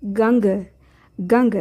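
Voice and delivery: गंग with the nasal ng sounded through the nose; teacher modelling गंग, nasal ng then ग; Sinhala ganga. Shown as a demonstration. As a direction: say गंग as gang-ga, nasal half n before ग. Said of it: "बोलिए मेरे साथ".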